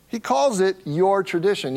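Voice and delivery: "your" in "He calls it your tradition" pressed hard; stress on your. Shown as a demonstration. Pastor pointing out Jesus' word choice, stressing "your". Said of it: "You can tell by my emphasis".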